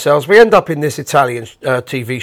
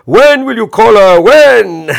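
put-on loud, high Italian-accented demand; shouting Italian host; 7 semitones higher, 7 dB louder, slower than usual. Host pressing Bob on air, loud Italian-accented shout. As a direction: hammer the question in an Italian accent. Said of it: "Red Ronnie says".

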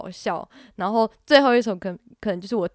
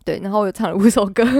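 ordinary talk, nothing marked

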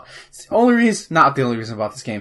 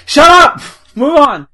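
loud playful shout; 13 semitones higher, 11 dB louder than usual. Real, not acted. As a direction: yell it at a teasing friend. Teased about forgetting frog's name.

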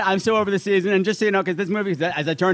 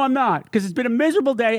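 ordinary talk

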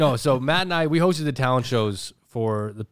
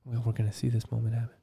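hushed commentary; 3 semitones lower, 7 dB quieter, faster than usual. Real, not acted. Friends whisper during phone call.

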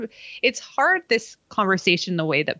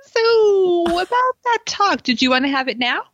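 a prodding, sing-song Jen opening; imitating Jen; 10 semitones higher than usual. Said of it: "And Jen walks up and she's like".

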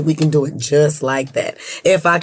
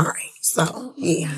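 whisper; 8 dB quieter, much slower than usual. Real, not acted. Whispers after saying a name.

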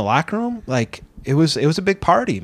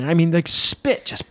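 ordinary talk, nothing marked

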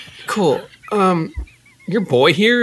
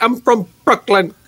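higher, put-on Brooklyn accent; deep Brooklyn accent; 4 semitones higher than usual. Trainee practicing for the taxi class, Brooklyn accent. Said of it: "practice this deep Brooklyn accent".

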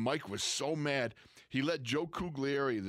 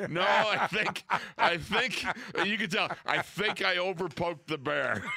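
high, loud and laughing, backing down; flustered laughing retreat; 8 semitones higher, 5 dB louder than usual. Retold or imitated. Coach admitting he overdid it, loud and laughing.

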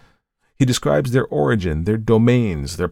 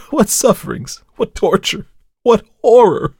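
high, loud, frantic cry to God; a man possessed crying out for mercy; desperate plea; 9 semitones higher, 7 dB louder than usual. Acted. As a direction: beg for rescue in rising terror. Diarist's terrified plea to God.

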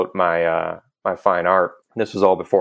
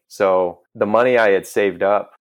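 normal talking voice

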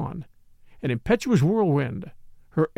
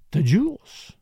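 breathed; slower than usual. A narrator's reading. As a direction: breathe it as a wondering question. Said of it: "breathed Morel".

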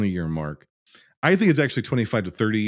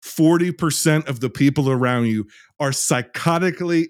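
exasperated rant; 5 semitones higher than usual. Real, not acted. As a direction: lean hard and long on each key word. Emphatic angry rant about ignorance.

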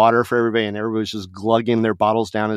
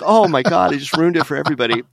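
mock dismay; 6 semitones higher than usual. Crowd reacts to poet.

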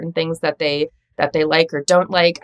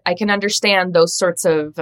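ordinary delivery

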